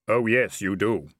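loud, solemn pronouncement; Death. Acted. Robed figure answers Vimes's hourglass question, solemn Death voice. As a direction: confirm it with sombre weight.

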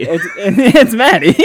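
loud, slow laughing reveal. Real, not acted. Host revealing the reviewer's name through loud laughter.

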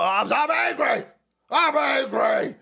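high, drawn-out mock shout; hammed-up impression of a raging politician; angry Joe Biden. Host mimicking the angry president, hammed-up strained yell. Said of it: "you're so angry".

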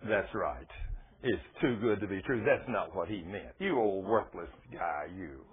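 low-pitched accusing voice, lightly acted; insinuating inner voice. Little voice in a believer's ear, insinuating. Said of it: "voice will whisper in your ear".

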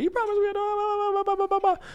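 squeaky high mocking whine, blah blah; whiny frustrated customer. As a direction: mimic her shrill complaint in a silly falsetto. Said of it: "she starts getting frustrated".

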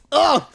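high mock gasp of disgust; James Bond. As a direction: imitate recoiling from a bad sip. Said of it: "imagine if James Bond, when he took a sip".

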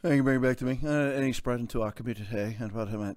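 mock the soothing health chief, soft and low; soft official imitation. Mocking the director general's calm tone, soft and low. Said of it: "they all sound like this and they all talk like this".

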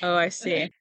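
usual speaking voice

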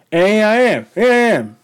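high, proud here I am; triumphant announcement. Real, not acted. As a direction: announce yourself like a champ.

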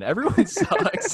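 joking to friends that everyone sucks; laughing. Real, not acted. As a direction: crack up while griping. Laughing rant about people.

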